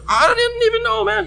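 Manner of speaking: very high mock wail; hammed-up plea of innocence; retold helpless protest. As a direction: squeal it in comic disbelief. His retold protest to boyfriend.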